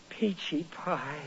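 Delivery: slow and soft, savouring the pet name; tender pet name. Acted. Husband fondly recalling his pet name for his wife, slow and soft. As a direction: croon it like a sweet nothing.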